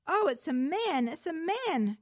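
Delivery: high, loud excited cry. A narrator's reading. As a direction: call out in startled excitement. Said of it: "cried the old fisherman".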